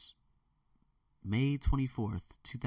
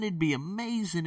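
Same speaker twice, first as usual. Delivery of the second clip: excited; 7 semitones higher than usual. Real, not acted. Excited about possible online earnings.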